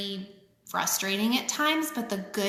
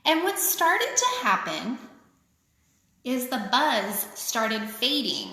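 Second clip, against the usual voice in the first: sing-song picture book reading; lilting read-aloud for kids; story reading. A narrator's reading. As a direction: read it like a rhyming children's book. Reads rhyming picture book aloud.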